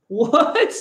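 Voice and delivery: high baffled what; mock disbelief. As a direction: squeak out a stunned what. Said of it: "you're like".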